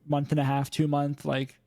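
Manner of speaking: ordinary talk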